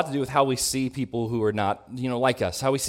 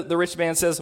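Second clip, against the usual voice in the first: ordinary delivery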